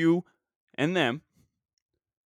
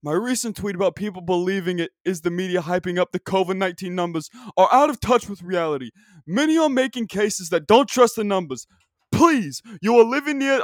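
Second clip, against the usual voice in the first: hyped-up announcer voice building higher and louder; Dick Vitale impression; 3 semitones higher, 4 dB louder than usual. Podcaster reads a second tweet in the sportscaster voice. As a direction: read it like a courtside rant gathering steam. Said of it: "This is my impression of Dick Vitale".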